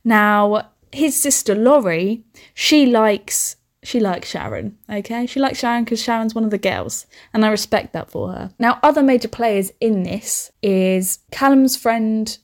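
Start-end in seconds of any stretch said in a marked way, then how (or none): none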